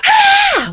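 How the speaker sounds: piercing high bird shriek; parrot scream. Retold or imitated. Parrot screaming right in her ear, shrill shriek. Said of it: "all of a sudden go".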